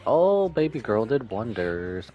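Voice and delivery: ordinary delivery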